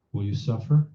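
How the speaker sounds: normal talking voice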